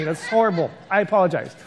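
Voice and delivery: normal talking voice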